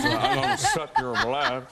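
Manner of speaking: hammed-up, high and slurred with false teeth; talking through fangs. Shown as a demonstration. Actor joking about his vampire teeth, mock fanged speech. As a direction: play a toothy vampire hampered by a retainer, laughing. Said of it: "when you're talking like that".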